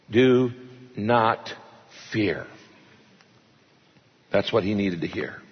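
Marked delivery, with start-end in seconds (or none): 0.1-2.5 gentle reassurance. Acted. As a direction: lay the words down gently. God's gentle answer quoted.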